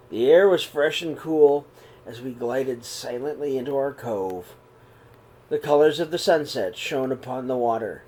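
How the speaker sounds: calm, even read-aloud voice; steady storybook telling; story narration. A narrator's reading. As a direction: read the story gently to the kids. Reads picture book aloud.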